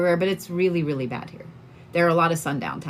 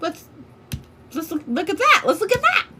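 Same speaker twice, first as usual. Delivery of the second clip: much higher, giddy let's look at that; finding the dream house online, giddy and rising high; excited; 11 semitones higher than usual. Retold or imitated. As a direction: squeal it with a laugh, can't wait to see. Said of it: "And I was like".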